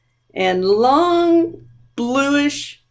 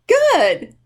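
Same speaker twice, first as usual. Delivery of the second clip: happy praise; 7 semitones higher, 3 dB louder than usual. Real, not acted. Praising children's chanting.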